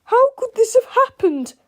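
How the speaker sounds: high, loud exasperated cry; Mister Krupp. A narrator's reading. Krupp covered in the mess, crying out in dismay. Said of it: "cried Mister Krupp".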